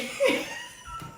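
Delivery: high squealing laugh; squealing laugh at the punchline; burst of laughter. Real, not acted. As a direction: let out a sudden high laugh. Laughs at mom's question.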